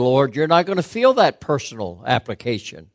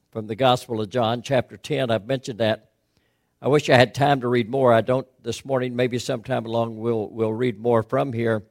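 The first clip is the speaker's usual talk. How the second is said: ordinary talk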